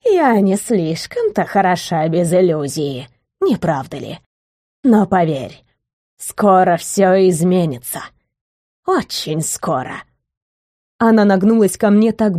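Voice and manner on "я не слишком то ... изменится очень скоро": put-on frail breathless old person. A narrator's reading. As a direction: rasp it out like a withered crone. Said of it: "проскрипело это существо разбитым старческим голосом".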